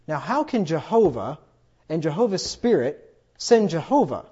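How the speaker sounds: ordinary talk, nothing marked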